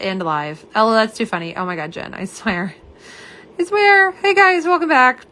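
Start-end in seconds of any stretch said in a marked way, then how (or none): 3.6-4.1 "I swear" flung out much higher and louder; exasperated outburst. Real, not acted. To viewers after ending live by mistake, exasperated outburst. 4.1-5.3 bright, much higher and louder greeting; excited welcome back. Real, not acted. To viewers rejoining after the stream cut, excited greeting.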